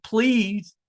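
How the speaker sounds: usual speaking voice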